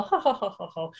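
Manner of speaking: ordinary talk, nothing marked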